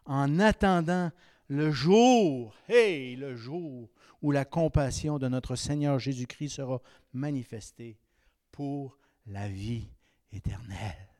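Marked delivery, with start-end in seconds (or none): none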